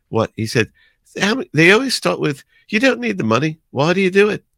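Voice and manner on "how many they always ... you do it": imitating Paul. Paul imitated quoting fans.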